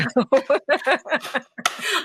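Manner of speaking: real high laugh bursting out; bright burst of laughter; hearty laugh. Real, not acted. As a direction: laugh out loud at the joke. Group laughing together.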